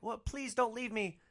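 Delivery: mock pleading, pitched high; a whiny beg not to be left; hammed-up sad plea; pleading boyfriend. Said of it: "And he's like".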